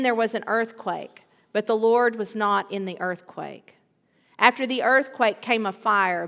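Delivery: normal talking voice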